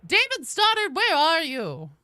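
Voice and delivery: high, loud calling out a name. Real, not acted. Streamer calling out for a missing player. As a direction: shout the name across the room.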